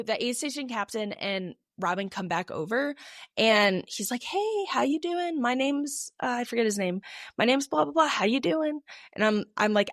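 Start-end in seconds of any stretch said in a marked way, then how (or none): 4.2-6.1 higher-pitched, drawn-out friendly hello; friendly captain greeting. Retold or imitated. Captain checking on a panicking runner, friendly greeting. 7.4-8.8 higher-pitched imitation of an easygoing helper; friendly captain greeting. Captain introducing himself to the runner, friendly greeting. Voicing the captain, name forgotten.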